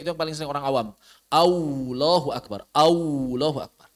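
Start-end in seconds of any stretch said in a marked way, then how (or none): 1.3-3.9 "Allahu Akbar" with the lam as w, "Aulahu"; w-sound Allah. Shown as a demonstration. Teacher imitating lay people's w-lam mistake. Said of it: "atau pakai W".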